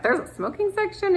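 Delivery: high, incredulous question; surprised. Retold or imitated. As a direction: ask it in mock disbelief. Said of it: "she's like".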